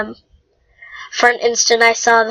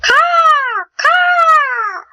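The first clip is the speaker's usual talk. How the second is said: crow caw retry. Acted. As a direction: squawk twice like a crow, trying harder. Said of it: "That was terrible".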